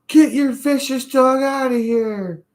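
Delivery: very high, loud mock screech; mad lady impression. Lady ordering a dog owner out of the park, furious mock screech. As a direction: imitate an angry woman screeching at the top of her voice. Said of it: "That lady was so mad".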